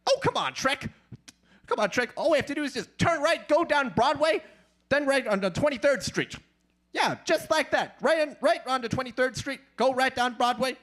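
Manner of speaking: high, excited fast-talking donkey; pushy sidekick nagging the driver, excited and high. Retold or imitated. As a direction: chatter eagerly like a cartoon donkey. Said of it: "Shrek and Donkey".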